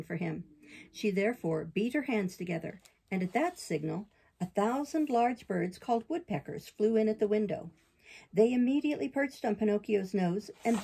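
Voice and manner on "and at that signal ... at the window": ordinary delivery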